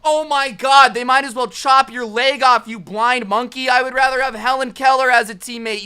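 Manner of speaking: high, loud furious gamer rant; screaming trash talk. Acted. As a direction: scream insults into the headset.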